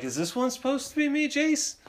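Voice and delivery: high-pitched mock dad asking; dad's voice. Dad asking his kid about a drawing, high-pitched imitation. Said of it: "So my dad's like".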